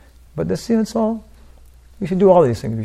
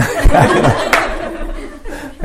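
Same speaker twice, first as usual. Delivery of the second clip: a high, airy burst of laughter; high breathy laugh; 12 semitones higher than usual. Real, not acted. Teacher laughing at the happy ending of his story, high and airy.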